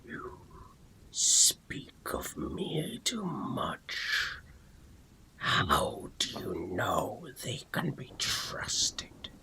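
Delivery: very slow, whispered sinister sword spirit; gravelly voice. Acted. Shadowy sword spirit reproaches its wielder, gravelly whisper. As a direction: rasp it out slowly in a breathy growl. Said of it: "the gravelly voice calls out".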